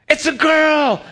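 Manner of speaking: joyful high shout of the news; excited shout. Retold or imitated. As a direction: shout it out, thrilled. Announcing daughter's birth, excited shout.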